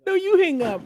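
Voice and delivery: very high squeal with you stressed; lovestruck banter. Acted. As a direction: throw it back, stressing you. Repeated lover banter.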